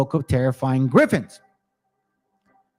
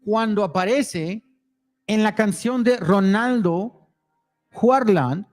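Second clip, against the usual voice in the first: reading the Spanish text aloud; steady Spanish read-through; reading foreign language. Real, not acted. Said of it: "read it in Spanish".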